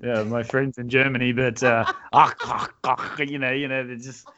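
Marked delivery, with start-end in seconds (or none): 2.1-3.2 guttural hacking "akh" sounds; mock German throat-clearing. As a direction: imitate harsh German throat consonants. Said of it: "they got something stuck in their throat".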